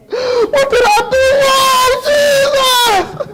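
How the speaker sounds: high, drawn-out hammed-up wail; wailing cry. Mother pleading with Jesus, hammed-up wailing. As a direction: cry out to heaven, slow and high. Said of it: "My mother's crying".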